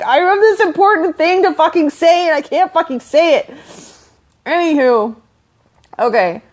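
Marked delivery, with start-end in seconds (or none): 0.0-3.5 high, exasperated mock rant; annoyed. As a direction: act out your own frustration, pitched way up. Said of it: "I just get annoyed when I'm like".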